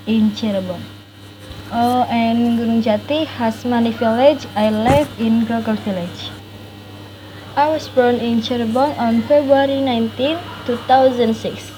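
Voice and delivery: usual speaking voice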